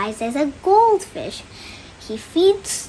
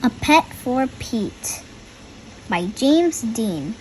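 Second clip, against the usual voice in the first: put-on reading to children; 2 semitones higher than usual. A narrator's reading. Reads the picture book aloud.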